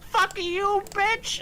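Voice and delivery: very high, cartoonish bot voice; absurdly high mock insult; Nightbot. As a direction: squeal it like a rude little bot. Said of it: "Nightbot was just like".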